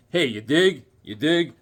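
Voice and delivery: lightly voiced cool private eye; a hint of a jaded detective drawl; imitating Johnny Double. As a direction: toss off the catchphrase like a laid-back hipster. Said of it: "he always says".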